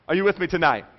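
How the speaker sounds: normal talking voice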